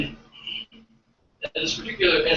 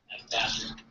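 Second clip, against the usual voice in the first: usual speaking voice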